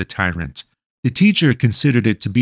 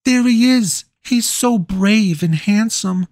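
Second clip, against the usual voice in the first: put-on squealing girls; 13 semitones higher than usual. A narrator's reading. Pretty girls squeal over their hero, high and swooning. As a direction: squeal like smitten schoolgirls. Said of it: "the pretty girls would squeal".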